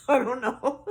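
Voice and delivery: can't help laughing at it. Real, not acted. Laughing at her own story.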